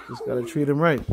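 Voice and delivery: normal talking voice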